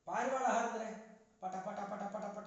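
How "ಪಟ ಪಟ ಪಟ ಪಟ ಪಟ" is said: quick rhythmic pata pata of flapping wings; pigeon wing flaps. Shown as a demonstration. Teacher imitates pigeon wings, a rapid flapping pata pata. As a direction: rattle it off like a pigeon taking off. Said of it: "ಅಂತ ಸದ್ದು ಬರುತ್ತೆ".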